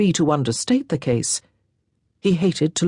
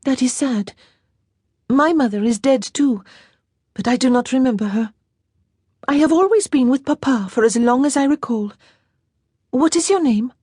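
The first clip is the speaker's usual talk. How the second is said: put-on light, higher girlish voice; a lightly voiced young lady, wistful; young Frenchwoman, a bit higher and brighter; young French girl; 8 semitones higher, 3 dB louder than usual. A narrator's reading. Girl's voice held.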